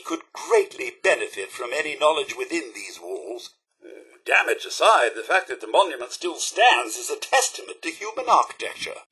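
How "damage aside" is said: ordinary talk, nothing marked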